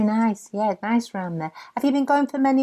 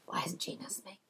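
whisper; 3 semitones lower, 17 dB quieter than usual. Retold or imitated. Inner jealous thought whispered.

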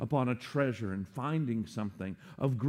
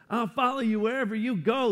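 high, eager promise; pumped-up volunteer; 9 semitones higher, 5 dB louder than usual. Acted. Eager man promising Jesus to follow him anywhere, pumped. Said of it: "This guy's pumped".

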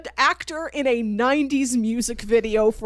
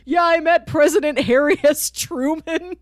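quoting a character; 7 semitones higher, 5 dB louder than usual. Retold or imitated.

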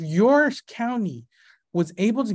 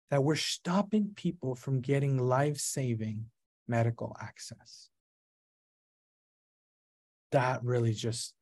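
lower, subdued and halting; somber; 4 semitones lower, 3 dB quieter than usual. Real, not acted. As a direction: tell it softly, heavy with held-back emotion. Recalls cancer patients afraid to seek treatment.

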